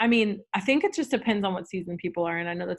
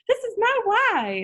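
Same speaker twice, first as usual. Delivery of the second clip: high, loud, slow grand pronouncement; hammed-up keynote speaker moment; mock stage declaration; 6 dB louder, slower than usual. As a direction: announce it like a big reveal on stage. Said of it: "be like".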